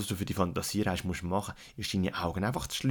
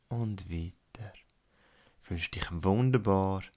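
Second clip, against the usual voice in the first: guided meditation. Acted. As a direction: lead a calm relaxation, unhurried. Guided relaxation fantasy journey.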